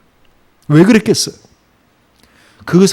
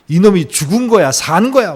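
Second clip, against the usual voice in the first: louder, higher angry bark; sudden yell; 5 semitones higher, 5 dB louder than usual. Retold or imitated. Older son snapping at his brother, sudden angry yell. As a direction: blurt it out in frustration. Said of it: "소리를 버럭 질렀어요".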